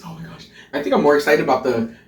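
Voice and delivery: ordinary delivery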